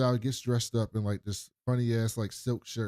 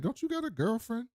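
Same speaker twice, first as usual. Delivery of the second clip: mock girlfriend voice; 11 semitones higher than usual. Voicing her teasing reply.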